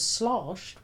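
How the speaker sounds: "slashed" stretched into a long "slahshed"; mock British vowels. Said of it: "must make use of only long vowel sounds".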